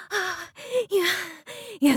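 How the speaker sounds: normal talking voice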